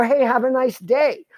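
slow, sugary friendly wave; overly nice. Retold or imitated. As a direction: kill them with kindness. Waving at a driver who cut him off.

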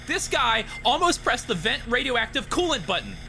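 high, loud alarmed shout. A narrator's reading. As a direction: yell it in alarm. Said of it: "Obayashi shouted".

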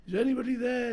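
high, loud séance call into the dark; spooky medium call. Acted. Medium calling spirits at séance.

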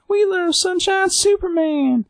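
mock crowd cheer, very high and drawn out; adoring crowd. Audience greets Sunshine Superman, high adoring chant. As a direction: swoon it slowly in a high fan voice.

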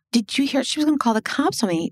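a lightly voiced hurt suitor, fast and high; mock indignant suitor. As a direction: play him as the aggrieved victim. Imitating him complaining to friend.

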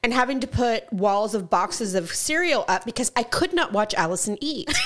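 ordinary talk

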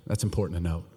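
usual speaking voice

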